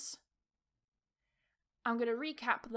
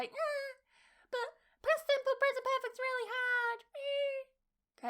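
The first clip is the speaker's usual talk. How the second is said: very high, put-on whining student voice; mocking high-pitched whinge; whiny student; 12 semitones higher, slower than usual. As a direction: whine like a complaining pupil. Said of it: "that's my whiny student voice".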